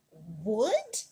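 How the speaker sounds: a lightly voiced, disbelieving rise; a hint of shocked empress; surprised question. Catherine glaring at the builders' wood, mildly shocked. Catherine sees the wooden slide.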